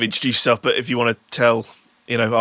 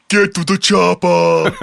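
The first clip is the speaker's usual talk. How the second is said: put-on higher, drawn-out Austrian accent call; Arnold Schwarzenegger; 5 semitones higher, 4 dB louder, slower than usual. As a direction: belt it out slowly with a hammy Austrian accent. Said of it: "I do that with Arnold Schwarzenegger".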